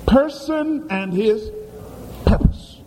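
Person and his slow, purpose hissed out; the answer drawn out for the church; congregation fill-in. Real, not acted.

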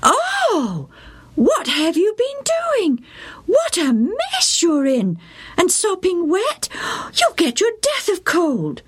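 high, fussing motherly scolding; dismayed mother. A narrator's reading. Mother fussing over her soaked son, high and dismayed. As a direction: scold him out of worry.

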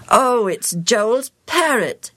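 put-on child voice. A narrator's reading. Child voice in story.

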